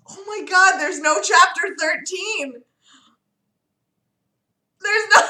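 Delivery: real high-pitched disbelief; astonished. Real, not acted. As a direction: gasp it out, voice way up. Reader discovers chapter thirteen is missing.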